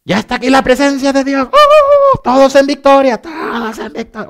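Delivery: mock revivalist scream, very high; theatrical worship frenzy. Preacher parodying believers claiming God's presence and victory, theatrical screaming. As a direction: overblown triumphant whooping and shouting. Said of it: "muy teatreros, ¿verdad? Como estos cuates".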